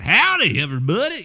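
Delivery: hammed-up Texas twang; mock Texan. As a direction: holler it like a cartoon Texan. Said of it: "shocked I was from Texas".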